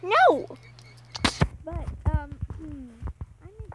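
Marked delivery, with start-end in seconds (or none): 0.0-0.5 sharp high loud no. Real, not acted. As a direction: bark it out at the pest. Snaps at sibling.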